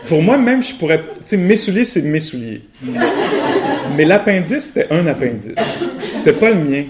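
amused, barely holding the laugh. Real, not acted. Amused about appendix.

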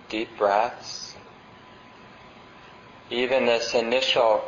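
unhurried meditation guidance with long pauses; guided meditation. Acted.

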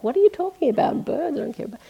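high, quick mock brush-off; dismissive. Pretend newcomer scoffs at keen bird watchers, mock dismissive. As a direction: wave off the birders, uninterested. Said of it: "You're like".

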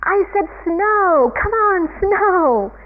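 high, loud demanding yell; Calvin. Retold or imitated. Calvin insisting that the heavens send snow, yelling. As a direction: holler it upward, stretching the words. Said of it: "he yells up at the".

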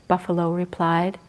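plain reading voice

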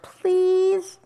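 high, drawn-out pleading; wheedling bird's plea; begging. A narrator's reading. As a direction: beg with one long high word. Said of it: "begged the bird".

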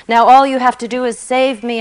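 loud, fast eager bargaining; eager pleading. A narrator's reading. Atheist eagerly bargains with God, fast and loud. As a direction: rush to convince him.

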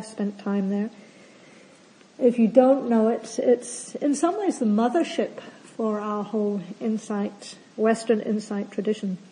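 normal talking voice

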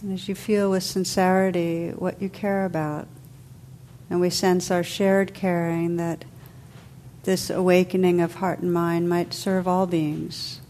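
calm, unhurried meditation voice with long pauses; guided meditation. Real, not acted. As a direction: guide listeners gently into stillness, letting phrases settle.

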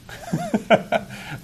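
short, high-pitched laugh. Real, not acted. Teacher amused by own remark on indulging, laughing. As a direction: break into a brief high laugh.

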